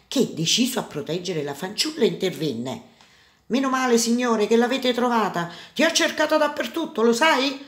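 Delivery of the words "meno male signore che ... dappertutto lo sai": put-on high, animated young rescuer; raised pitch, eager bluff; feigned relief. A narrator's reading. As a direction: act relieved at finding a lost sister. Boy pretends relief to merchant.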